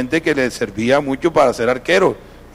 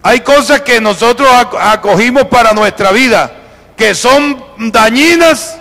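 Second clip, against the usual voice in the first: higher, loud preaching voice; predicación enérgica; 7 semitones higher, 6 dB louder than usual. Acted. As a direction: drive each phrase home to the congregation. Preaches at full force. (prédica a plena voz)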